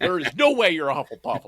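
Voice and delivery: mock angry friend. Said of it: "genuinely angry".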